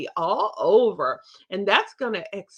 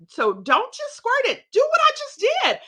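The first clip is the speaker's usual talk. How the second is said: emphatic coaching; 14 semitones higher, 4 dB louder than usual. Shown as a demonstration. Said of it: "So you do that".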